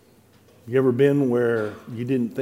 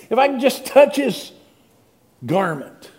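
much higher and louder, pressing on touch; urgent pleading; 9 semitones higher, 5 dB louder than usual. Acted. Sick woman in the crowd, pleading, higher and louder. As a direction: plead it, reaching out.

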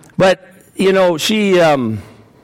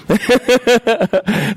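a burst of real laughter, higher; 7 semitones higher than usual. Real, not acted. Punchline of his own story.

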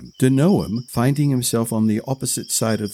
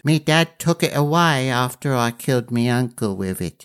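put-on young boy voice; 2 semitones higher than usual. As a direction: pitch up into a cheeky lad. Boy confesses.

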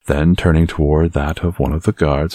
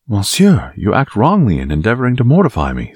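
higher, bristling protest; quarrelsome; 6 semitones higher than usual. A narrator's reading. Young Gascon objects to being mortified, quarrelsome and higher. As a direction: let the quarrelsome spirit rise in pitch. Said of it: "began to speak more loudly".

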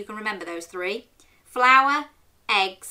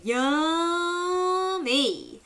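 high, long-drawn-out relish; swooping high, then falling at the end; savouring; 8 semitones higher than usual. Shown as a demonstration. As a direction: stretch the word way out with delight. Demonstrates word for children to repeat.

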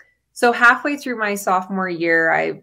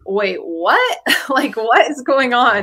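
retold shocked reaction, laughing, louder at the end; shocked confusion; 4 semitones higher, 5 dB louder than usual. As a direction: laugh through a stunned question. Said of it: "And I was like".

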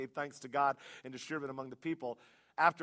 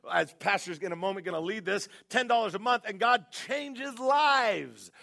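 loud, high preacher voice with stretched emphasis; full-force preaching; 8 semitones higher, 10 dB louder than usual. Acted.

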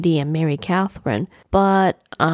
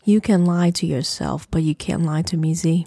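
usual speaking voice

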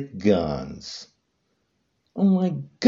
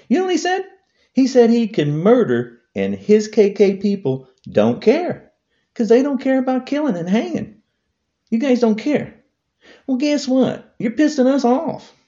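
higher, heated rant building; angry rant; 10 semitones higher than usual. Real, not acted. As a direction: vent the anger rising. Angry rant about Trump.

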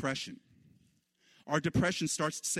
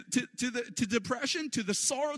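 ordinary talk

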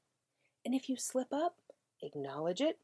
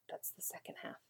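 whispered aside; 14 dB quieter than usual. Real, not acted.

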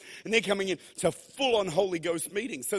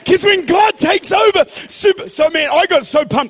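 normal talking voice

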